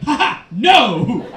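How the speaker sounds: loud fake laugh into a drawn-out no; hammed-up gloating put-down; mocking refusal. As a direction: laugh scornfully, then refuse with relish. Acts body part mocking the brain.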